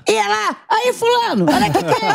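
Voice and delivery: hammed-up starstruck cop voice; imitating a cop. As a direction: mimic the cop spotting a celebrity. Retelling policeman recognizing her.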